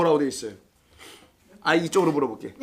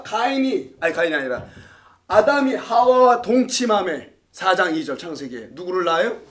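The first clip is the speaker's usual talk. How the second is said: ordinary talk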